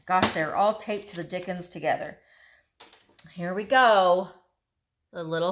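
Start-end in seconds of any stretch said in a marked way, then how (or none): none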